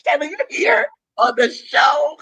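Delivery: excited shout. Real, not acted. Excited guest greeting host.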